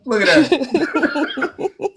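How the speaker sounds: usual speaking voice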